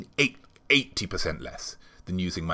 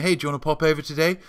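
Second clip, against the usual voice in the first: fake friendly invite; 8 semitones higher, 3 dB louder, much faster than usual. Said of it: "pretending to be Corona".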